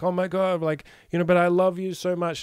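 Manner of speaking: high, loud, overwrought gushing; mock-tearful high gush to her man; mock gushing girlfriend. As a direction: ham up the weepy partner. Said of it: "You'll be like".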